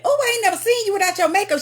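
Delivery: a quick, high, amazed remark; surprised blurt. Retold or imitated. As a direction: blurt it out in disbelief.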